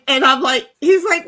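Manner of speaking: plain reading voice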